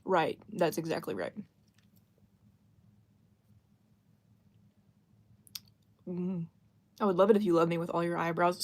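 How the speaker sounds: talking with food in mouth; words around a bite of food; chewing while talking. Real, not acted. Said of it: "Sorry for the chewing".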